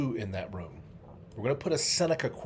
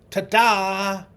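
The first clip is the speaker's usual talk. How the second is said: much higher and louder showman's ta-da; big magician's ta-da; 9 semitones higher, 11 dB louder than usual. Pretend. Memory-palace magician revealing the quote, grand ta-da. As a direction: belt it like the grand finale of a trick.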